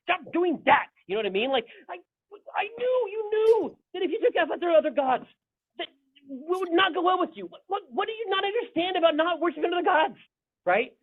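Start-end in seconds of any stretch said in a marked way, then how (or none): 0.0-0.9 exasperated prophet ranting. Retold or imitated. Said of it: "the prophets are gonna come and they're gonna be like". 1.9-10.2 high mock exasperated rant; exasperated prophet ranting. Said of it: "missed the whole rant".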